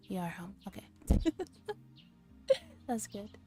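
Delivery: ordinary delivery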